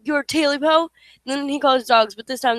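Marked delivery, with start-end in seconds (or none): 0.0-0.9 much higher, louder frightened yell; loud scared yell; yelling back. Acted. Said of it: "Smee yelled back".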